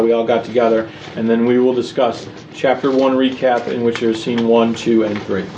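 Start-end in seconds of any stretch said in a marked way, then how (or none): none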